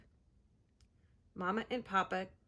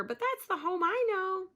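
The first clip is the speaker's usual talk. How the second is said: high gull voice; chirpy gull voice; 9 semitones higher, 4 dB louder than usual. Acted. Gull answering Pip in a high voice. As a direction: chirp it like a seabird.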